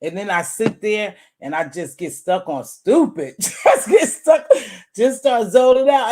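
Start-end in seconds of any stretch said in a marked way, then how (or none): 3.4-6.1 laughing. Real, not acted. Laughing about getting stoned.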